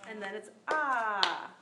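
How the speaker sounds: slow open ah from the throat, ah drawn out long; held open ah. Shown as a demonstration. Teacher demonstrating the long ah to the class, held open.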